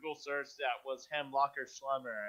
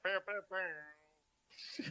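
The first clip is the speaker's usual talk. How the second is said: air horn noise; 3 semitones higher, 7 dB quieter, much slower than usual. Pretend.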